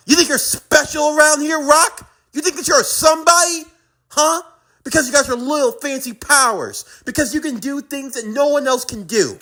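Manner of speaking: high, scornful needling; mock their self-importance with rising anger; angry taunt. Taunting in acted quarrel.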